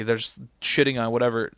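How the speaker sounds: usual speaking voice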